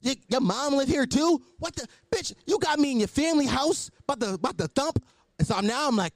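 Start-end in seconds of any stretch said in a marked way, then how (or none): 0.0-5.0 shocked disbelief. Retold or imitated. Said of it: "I was like".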